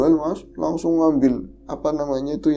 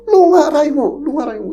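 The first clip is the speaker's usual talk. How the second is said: shaman's shout; 12 semitones higher, 8 dB louder than usual. Retold or imitated. Village shaman shouting at ghost.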